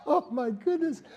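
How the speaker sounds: Oh my goodness high and laughing; laughing delight. Retold or imitated. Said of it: "I laughed. I was like".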